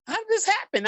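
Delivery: high-pitched retold bewilderment; confused, upset. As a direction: act out stunned disbelief, pitch way up. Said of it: "hurt, confused".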